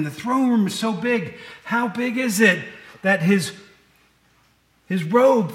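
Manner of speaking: lifted preacher's voice; preaching. Acted. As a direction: preach it out to the room. Preacher retelling Isaiah.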